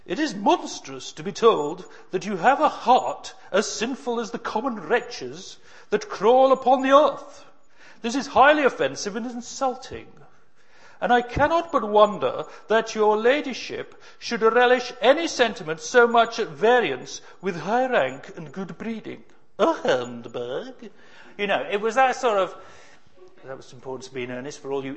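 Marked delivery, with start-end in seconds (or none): 0.0-19.2 high, loud, haughty grand-dame outrage; Lady Bracknell voice. Retold or imitated. Preacher doing Lady Bracknell for the congregation, haughty and loud. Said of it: "I'll do my Lady Bracknell voice here". 19.6-20.9 lightly voiced grand dame, slow; a touch of aghast Bracknell drawl; Lady Bracknell line. Preacher tossing in the famous line, mock aghast. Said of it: "Lady Bracknell voice".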